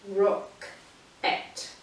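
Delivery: whispered rock-et, slow, with a gap; slow two-part rock-et; split into syllables. Shown as a demonstration. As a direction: separate rock-et into two beats. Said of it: "that's where we break it up".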